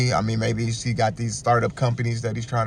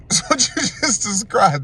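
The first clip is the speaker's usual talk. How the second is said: high, slow words through laughter; laughing; 7 semitones higher than usual. Real, not acted. Host telling him he described nonchalant, laughing incredulously. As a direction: laugh while pointing out the irony.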